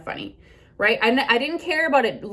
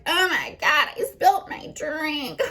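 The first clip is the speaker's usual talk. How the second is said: put-on ditzy drunk friend; 6 semitones higher than usual. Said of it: "your friend's like".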